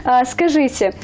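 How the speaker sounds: usual speaking voice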